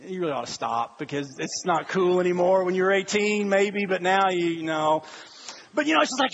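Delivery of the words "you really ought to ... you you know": low, subdued disapproval; embarrassed onlooker. Acted. Onlooker to middle-aged fans at a concert, low and disapproving. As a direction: talk them down in a lower voice.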